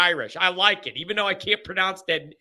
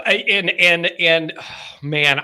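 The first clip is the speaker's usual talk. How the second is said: normal talking voice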